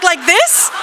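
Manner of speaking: high, laughing "Like this?"; eager question. Retold or imitated. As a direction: ask it bright and high through a laugh. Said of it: "I'm like".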